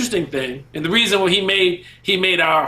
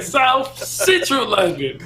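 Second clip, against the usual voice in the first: put-on British accent; 7 semitones higher, slower than usual. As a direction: imitate a British accent slowly, higher, relishing it. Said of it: "the British accent?".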